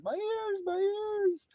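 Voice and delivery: very high-pitched mock wail; OC Stacker complaining. Imitating a viewer who hates the singing, high wail. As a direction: squeal it as a pained complaint. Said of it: "'cause he always says".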